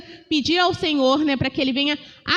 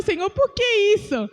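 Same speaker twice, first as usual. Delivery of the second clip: complaining to God; 5 semitones higher than usual. Retold or imitated. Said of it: "e ele fica assim desse jeito chorando".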